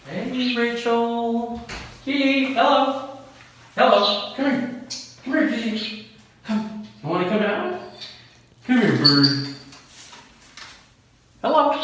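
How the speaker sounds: high, loud sing-song calling to birds; coaxing a wild bird. Real, not acted. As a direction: call the birds up high and bright. Greeting parrots after a trip.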